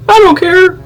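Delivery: very high, loud mock squeal; dismissive comeback. Vlogger retelling a comeback to a hateful commenter, high mock squeal. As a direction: squeal it loudly, brushing off the insult.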